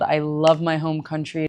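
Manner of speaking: usual speaking voice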